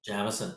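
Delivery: "Jameson" said softly as JAM-uh-sun; soft "Jameson" starting like the word jam; short-A Jameson. Shown as a demonstration. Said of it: "Like jam".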